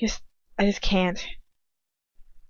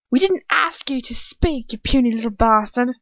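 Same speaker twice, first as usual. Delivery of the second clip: higher, contemptuous angry snarl; angry outburst; 4 semitones higher, 5 dB louder than usual. A narrator's reading. Dolohov to Ron after punching him, angry. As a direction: spit the insult out, getting louder.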